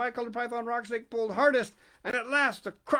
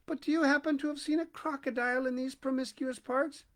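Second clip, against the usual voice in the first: high, polite little voice; polite elephant's child; 6 semitones higher than usual. A narrator's reading. Elephant's child politely asking the crocodile. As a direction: ask very politely, like a small child. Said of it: "most politely".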